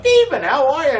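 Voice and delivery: loud, very high comic greeting; big, high-pitched comedy delivery. Shown as a demonstration. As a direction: swing the pitch up like a stage comic. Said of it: "my comic voice, which goes up and down".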